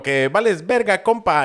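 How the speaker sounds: put-on a high, swaggering Mexico City chilango accent. Imitating the Mexico City chilango accent for his friend. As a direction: sneer it like a street chilango. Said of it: "el típico acento chilango".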